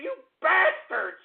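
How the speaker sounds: high, slow furious yell; hammed-up fury at prank callers; angry outburst. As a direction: spit it out in outrage. Prank call meltdown rant.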